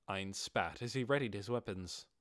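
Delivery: ordinary delivery, nothing marked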